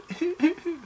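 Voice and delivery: soft, high mock-crying whimpers; small high whimpery cry sounds; sweet whimper. Shown as a demonstration. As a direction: imitate a sweet little whimpering sob. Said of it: "whimpering kind of".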